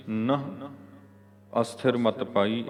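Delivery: ਨਹ held alone as nasal "nah", then a pause; slow model reading with isolated "nah" for ਨਹ. Shown as a demonstration. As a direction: sound ਨਹ through the nose, then read on. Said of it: "ਏਦਾਂ ਪੜ੍ਹਨਾ".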